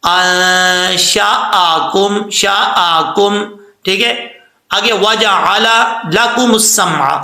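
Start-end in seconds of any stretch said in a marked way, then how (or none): 0.1-3.4 अंशआकुम drawn out with a clear glottal stop; clear hamza. Shown as a demonstration. Teacher demonstrates the hamza slowly. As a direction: stretch it, hamza audible. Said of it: "हमजा को sound के साथ पढ़ेंगे".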